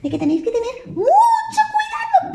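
high, laughing little bird voice; sing-song tiny bird greeting the piglets; chirpy high bird warning. Acted.

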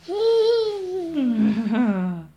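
loud burst of laughter; hearty laugh. Real, not acted. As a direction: laugh out warmly. Storyteller laughs.

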